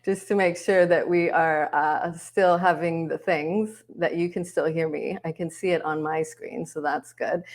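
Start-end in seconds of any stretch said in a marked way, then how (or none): none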